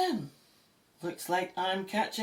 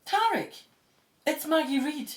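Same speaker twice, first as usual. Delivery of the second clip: higher, louder eager hello; bright greeting; 8 semitones higher, 5 dB louder than usual. Acted. Maggie calling Tarek with good news, eager. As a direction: call his name with good news.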